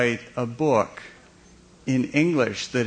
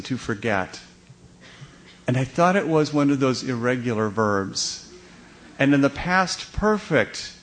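ordinary delivery

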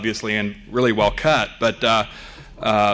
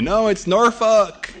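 put-on Native res accent, slow and drawn out, pitched up; yelling it back in a res accent; 10 semitones higher, much slower than usual. Audience yelling a correction, in a res accent. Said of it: "I just did it with a res accent".

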